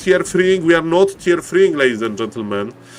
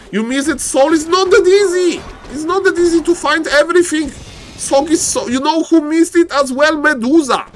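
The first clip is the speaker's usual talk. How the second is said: high-pitched, raised-voice exclaiming; excited disbelief; 11 semitones higher, 3 dB louder than usual. Real, not acted. Caster telling viewers who missed it, excited disbelief.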